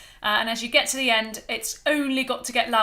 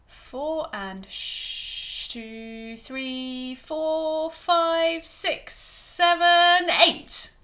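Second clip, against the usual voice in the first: bigger crescendo; 5 semitones higher, much slower than usual. Shown as a demonstration. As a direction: swell hard so the last counts ring out. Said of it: "make the crescendo more".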